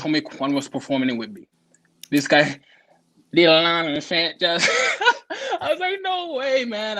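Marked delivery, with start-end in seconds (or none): 4.7-5.6 sudden high burst of laughter; cracking up mid-story. Real, not acted. 5.6-7.0 laughing. Real, not acted. Laughing at the memory.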